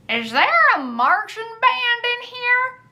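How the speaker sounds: put-on high, drawn-out old man's voice; old man calling out. A narrator's reading. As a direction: call across the shop as a quirky old-timer. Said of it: "Henry called".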